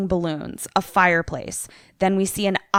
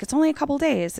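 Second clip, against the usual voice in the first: lilting higher-pitched mock reassurance; breezy reassurance; 8 semitones higher, much faster than usual. Grace telling the dad she will manage, breezily. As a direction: imitate someone airily brushing off a worry.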